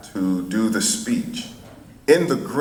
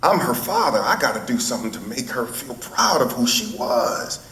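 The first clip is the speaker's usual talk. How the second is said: higher-pitched, impassioned oratory; Martin Luther King; 3 semitones higher than usual. Retold or imitated. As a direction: imitate a father addressing an audience with conviction. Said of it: "he said to the audience".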